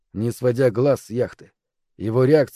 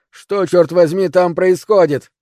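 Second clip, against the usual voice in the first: higher, louder, baffled demand; shout; 5 semitones higher, 6 dB louder, slower than usual. A narrator's reading. Man on pier demanding news from aboard, shouting. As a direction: shout the question across a distance. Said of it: "крикнул один из оставшихся".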